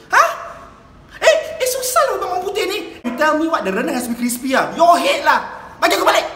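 loud, fast, high indignant rant; exasperated outburst. Acted. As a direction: scold the clueless guest. Angry outburst at guest.

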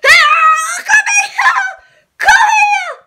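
high, loud "come here"; chasing shout. Pretend. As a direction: yell as you chase them.

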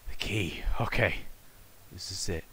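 soft, whispered aside; under the breath; hushed. Real, not acted. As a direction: whisper it to yourself. Hushed reaction during game.

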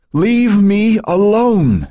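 loud, high, drawn-out scream; desperate full-voiced shriek. A narrator's reading. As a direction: scream it out slowly at the tormentor. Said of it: "Joan screamed".

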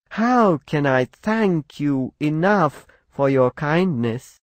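high, slow, thrilled bird voice; delighted little bird. Acted. Grateful little bird thanking the spruce, high and delighted. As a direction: gush your thanks, tiny and high. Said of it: "exclaimed the little bird".

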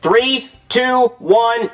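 high, slow count to one; drawn-out countdown. Acted. Doctor counting down to the pull, drawn-out and high. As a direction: stretch each number, pitched well up.

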